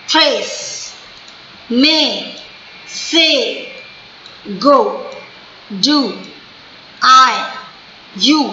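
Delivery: "trace, may, say, go, do, I, you" as separate single beats; one stress each. Shown as a demonstration. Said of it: "इन सभी words में एक एक stress थी".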